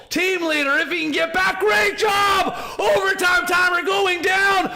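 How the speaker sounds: fast, high, shouting caster calling the action; live play-by-play. Acted. Caster calling the Kraken's final push, roaring live play-by-play. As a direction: ride the hype of every elimination like a stadium caster.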